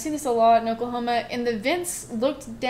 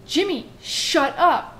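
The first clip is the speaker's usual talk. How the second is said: high, stretched-out shout at a brother, reenacted; acted exasperated holler; retold yell; 5 semitones higher, 4 dB louder, much slower than usual. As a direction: call down crossly, drawing out each word. Retold yelling down the vent.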